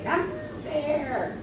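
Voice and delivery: put-on childish whine. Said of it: "they would be like".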